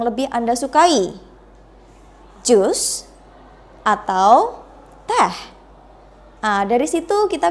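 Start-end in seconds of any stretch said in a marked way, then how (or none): 2.4-5.3 "Jus" rising, then "teh" dropping; choice-question intonation. Shown as a demonstration. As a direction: lift "Jus" as first option, let "teh" fall to close. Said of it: "menggunakan raising intonation".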